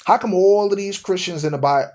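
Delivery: ordinary talk, nothing marked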